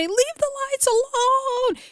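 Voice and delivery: high, slow, strained yell mimicking Rolly; imitating Rolly yelling. Recapping the bus fight, mimicking Rolly's high slow yell. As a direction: stretch it out like a frantic plea. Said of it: "You just hear Rolly say".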